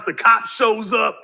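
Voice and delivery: ordinary delivery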